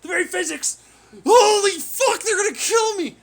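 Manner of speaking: hammed-up shrieks of terror; mock panicked screaming. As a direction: imitate someone screaming for their life, high and very loud. Resumes the acted panicked screaming.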